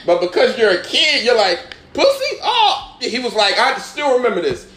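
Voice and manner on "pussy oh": very high, squeaky mock surprise; hammed-up teenage bafflement, pitched way up; clueless shock. Kid reacting to an older boy's question, mock shocked.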